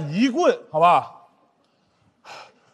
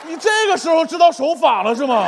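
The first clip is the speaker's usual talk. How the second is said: high-pitched comic indignation; high, incredulous complaint; exasperated protest; 4 semitones higher than usual. Acted. Protests the kidnapper's absurd lawfulness.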